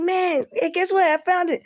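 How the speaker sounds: high, loud, rushed good news; retelling your own excited babble; happy excitement. Retold or imitated. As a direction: burst out with relieved excitement. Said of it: "I'm so damn happy".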